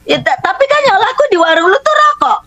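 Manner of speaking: high-pitched retold objection; exasperated protest. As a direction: imitate a father arguing in disbelief. Father retold protesting her decision.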